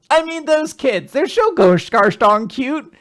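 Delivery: high-pitched, hammed-up cutesy voice; mock-adoring squeal; gushing detective. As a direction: gush in a put-on high voice, drawing out the end. [name] gushes about the cute kids.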